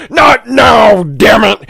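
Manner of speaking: loud, snapping father yell; angry dad shout. Pretend. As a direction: yell at the kids from the front seat. Dad yelling at kids.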